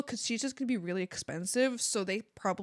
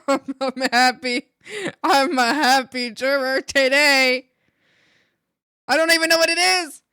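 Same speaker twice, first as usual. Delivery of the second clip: loud, gushing delight; excited; 2 semitones higher, 11 dB louder than usual. Real, not acted. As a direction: burst out with happiness. Gushing over the food.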